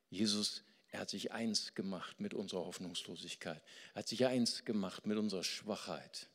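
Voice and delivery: ordinary delivery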